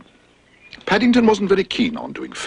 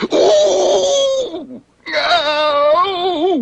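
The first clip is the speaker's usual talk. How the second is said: high, loud cries of ooh and ow; excited cries; 4 dB louder than usual. Acted. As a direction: echo the dentist's yelps. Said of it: "repeated Paddington".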